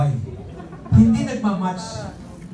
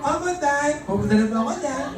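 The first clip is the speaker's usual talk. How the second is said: higher, fast, laughing reply; son answering; 8 semitones higher than usual. Retold or imitated. Son answering his father, higher and fast. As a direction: hurry the answer back to dad. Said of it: "Sabi ng anak niya".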